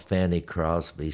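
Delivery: ordinary talk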